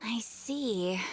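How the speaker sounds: unvoiced, low aside; whispered aside. Nahida's aside while posing as Catherine, whispered. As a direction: drop it under your breath.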